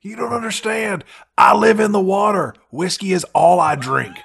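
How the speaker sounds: high, loud surfer bro; gym bro. Acted. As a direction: brag like a beach bro. S1 joins Aquaman bro voice.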